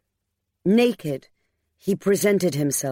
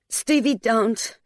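higher, slow, weary plea; pleading; 6 semitones higher, slower than usual. A narrator's reading. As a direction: draw it out as a plea. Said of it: "she pleaded".

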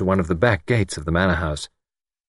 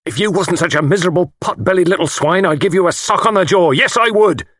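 put-on high, loud drunken threat; threatening; 11 semitones higher, 5 dB louder than usual. A narrator's reading. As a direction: threaten the boss, building louder. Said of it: "took a threatening step forward".

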